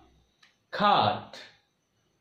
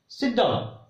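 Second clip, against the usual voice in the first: ordinary delivery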